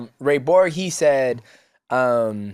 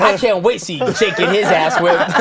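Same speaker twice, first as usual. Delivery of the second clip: mocking laugh; 7 semitones higher, 5 dB louder than usual. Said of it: "he was like".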